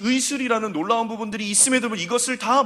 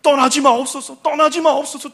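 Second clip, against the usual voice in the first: pleading Moses; 7 semitones higher, 8 dB louder than usual. Retold or imitated. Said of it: "두 번이나 붙들어요".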